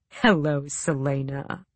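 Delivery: low, slow, silky purr. Acted. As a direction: savor her name like a cat. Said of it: "he purred".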